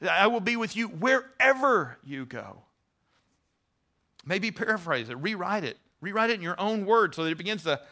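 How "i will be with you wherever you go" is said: WHEREVER stretched and lifted above the rest; "wherever" drawn out as the key word; stress on WHEREVER. Shown as a demonstration. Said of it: "emphasize the different words".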